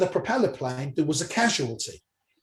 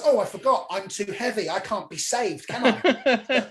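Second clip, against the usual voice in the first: sarcastic wife; 3 semitones higher than usual. Retold or imitated. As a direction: throw it back at him as the offended wife. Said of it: "then she goes".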